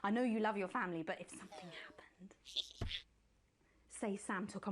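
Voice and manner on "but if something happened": hushed whisper. Acted. Host whispering the dark hypothetical to Alex. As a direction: drop to a whisper.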